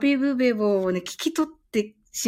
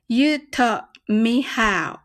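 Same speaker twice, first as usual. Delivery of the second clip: slow demo; much slower than usual. Shown as a demonstration. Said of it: "次が".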